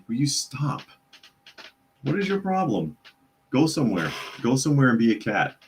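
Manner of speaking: exasperated plea to behave; exasperated scolding. Real, not acted. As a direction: scold a pesky pet. Scolding his cat mid-stream.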